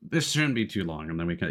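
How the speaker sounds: ordinary talk, nothing marked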